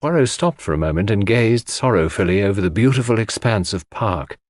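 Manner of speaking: ordinary delivery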